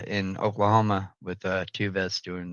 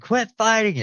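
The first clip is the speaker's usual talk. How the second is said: put-on high-pitched kid yell, not much louder; retold scream; 12 semitones higher, 4 dB louder than usual. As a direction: scream it high like a scared kid breaking up a fight. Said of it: "Like, I screamed".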